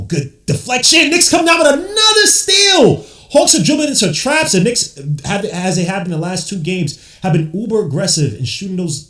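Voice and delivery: excited call peaking high on the steal, then settling; energetic basketball commentary; live play-by-play. Real, not acted. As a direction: announce the steal big, then keep the commentary rolling.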